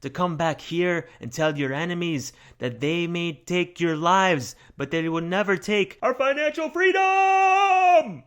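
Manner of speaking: mock Scottish war speech, swelling from a slow rally to a full battle cry; hammed-up movie battle-speech accent. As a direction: rouse the troops before battle in a mock Scottish brogue, ending in a roar. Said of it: "I really wish I could do proper accents".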